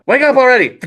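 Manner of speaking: high, loud yell to wake someone; exasperated yell. Acted. Zhen fed up with sleeping Po, exasperated yell. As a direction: lose patience and yell it high.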